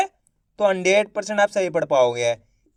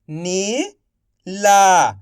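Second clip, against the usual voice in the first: slow stretched reading; much slower than usual. Shown as a demonstration. Teacher to pupils, slowly stretched. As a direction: draw Neela out slowly. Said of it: "आप इस तरीके से".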